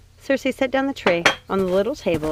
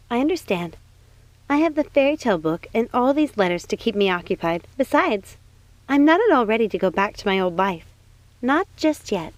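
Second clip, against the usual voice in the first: a higher, bright-eyed princess voice; 4 semitones higher than usual. Acted.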